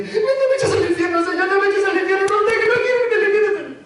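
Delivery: high, loud, whining plea; pleading sinner. Retold or imitated. Preacher mimics people begging God not to send them to hell, high wailing. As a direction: beg like a frightened child. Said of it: "le van a decir".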